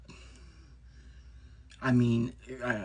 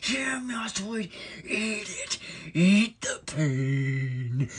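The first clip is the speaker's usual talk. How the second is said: mock sadistic gloating; 9 semitones higher, much slower than usual. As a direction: drag out the last word as you pour on the curse. Gloating while torturing a rival by spell.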